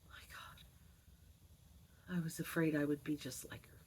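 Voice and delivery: unvoiced, soft fearful whisper; whispered confession. Real, not acted. Woman whispering her fear of becoming her mother. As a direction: breathe it out as a secret.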